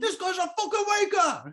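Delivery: very high, hammed-up Australian jeer; shouted Australian accent. Comic mocks the robber for his co-host, shouted Australian accent. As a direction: repeat it as a mock-Australian holler. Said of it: "every time I do my Australian voice".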